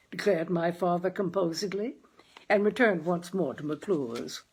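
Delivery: ordinary delivery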